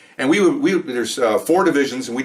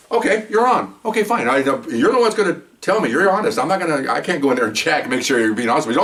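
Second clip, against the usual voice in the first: voicing his female partner much higher; imitating partner; 5 semitones higher than usual. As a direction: pitch up to play the partner accepting the bet. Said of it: "And she goes".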